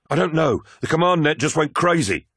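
high, urgent officer report; alarmed reply. Acted. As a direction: blurt it out in alarm.

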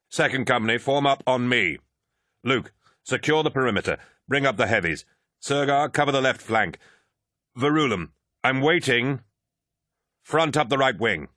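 rally the troops over the vox; commanding orders. Acted.

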